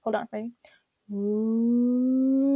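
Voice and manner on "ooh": loud hoot. Real, not acted. Testing the sound wave for listeners, a loud hooting ooh. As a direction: blast one loud hoot to watch the sound wave jump. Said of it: "that probably killed your ears".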